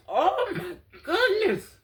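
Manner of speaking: slow, high laughing whimper; laughing cry. Real, not acted. Recalling the gory video, laughing and whimpering.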